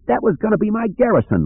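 high, cracking wail of dismay; voice cracking cry. A narrator's reading. Lando watching his garrison destroyed, voice cracking high. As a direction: let the voice crack up high in despair. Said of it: "Lando cried. His voice cracked".

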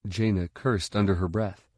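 usual speaking voice

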